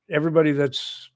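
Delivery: usual speaking voice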